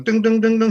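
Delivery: high sung ding ding imitating a piano key; mock piano notes. Old pianist testing a piano, singing ding ding high. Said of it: "he was going".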